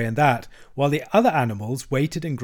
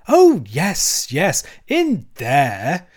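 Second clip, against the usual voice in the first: high, loud, stretched-out dismissal; impatient; 4 semitones higher, 6 dB louder, much slower than usual. A narrator's reading. Toad brushing off the badger's reminder, impatient. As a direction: brush it off impatiently, dragging the last words. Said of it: "said the Toad impatiently".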